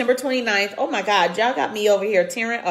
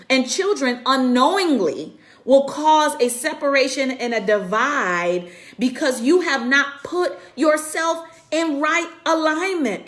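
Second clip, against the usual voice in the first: usual speaking voice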